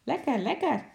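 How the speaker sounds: high, squawking parrot voice; schreeuwende papegaai. A narrator's reading. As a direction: squawk it like a cheeky parrot, pitch shooting up at the end. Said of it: "de paar woorden schreeuwde". (hoge, krijsende papegaaienstem)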